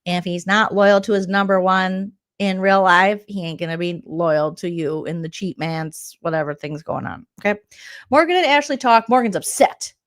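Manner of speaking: normal talking voice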